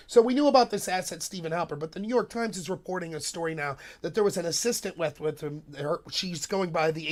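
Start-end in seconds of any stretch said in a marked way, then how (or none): none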